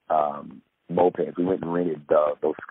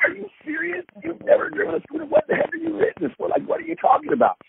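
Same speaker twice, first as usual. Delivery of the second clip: high-pitched incredulous rant; mock-exasperated questions, pitched way up; retold disbelief. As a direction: re-enact scolding a friend in a high, squeaky voice. Retelling disbelief at friend's confession.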